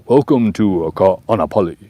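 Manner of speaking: hammed-up luau emcee welcome; luau host. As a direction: announce it like you're greeting guests at a luau. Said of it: "Say it like you're at a luau".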